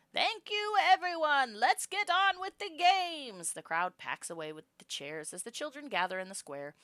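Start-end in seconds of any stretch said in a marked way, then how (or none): 0.1-3.5 put-on high, loud, slow rattling old voice; weedy old governor. Governor Udicott opening the games, in a high, slow, loud weedy voice. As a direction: crow it thinly through a megaphone. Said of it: "Udicott's weedy voice rattles through the maxophoner".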